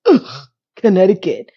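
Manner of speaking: a mock groan of disgust at the place. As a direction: sneer the name like it smells bad. Date disgusted by Connecticut.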